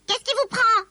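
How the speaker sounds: high, loud, angry elf voice; irate squeaky elf outburst; furious elf. A narrator's reading. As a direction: snap back furiously in a high little voice. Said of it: "répondit une voix furibonde".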